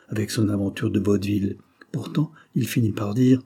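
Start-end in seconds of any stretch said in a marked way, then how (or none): none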